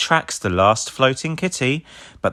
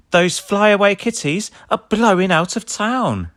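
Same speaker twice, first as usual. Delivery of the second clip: much higher, louder alarm; urgent warning; 11 semitones higher, 4 dB louder than usual. A narrator's reading. Sky warning Ryder, higher and louder. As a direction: warn the leader fast. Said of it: "says Sky".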